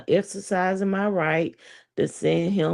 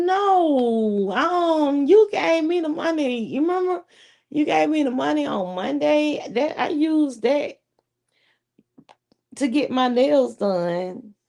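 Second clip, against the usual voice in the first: high, earnest explaining voice; retold explaining; 7 semitones higher than usual. Telling her man she already used the money, high. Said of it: "I was like".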